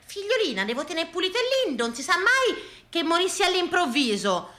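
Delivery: high-pitched housewife's excuse; high, self-justifying neighbour voice; fussy neighbour. Acted. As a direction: answer back high as the house-proud neighbour. Neighbour's reply acted out.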